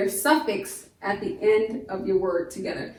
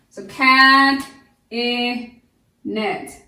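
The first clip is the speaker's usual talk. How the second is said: "cab" loud, then "e", then "net" fading, spaced apart; syllable by syllable; 5 dB louder, much slower than usual. Shown as a demonstration. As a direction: count out "cab, e, net" one by one. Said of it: "The I in cabinet".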